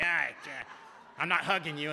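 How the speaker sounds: loud, mock-reluctant protest; hammed-up reluctance; grudging refusal. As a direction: balk out loud like someone dodging a hug. Acted grudging line-goers refusing a hug.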